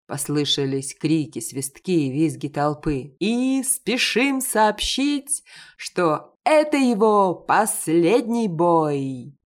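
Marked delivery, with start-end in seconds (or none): 3.2-9.4 high, loud, slow announcer call; ring announcer. A narrator's reading. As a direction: stretch it out like a boxing ring announcer. Announcer continues. (голос ринг-анонсера, громко и протяжно)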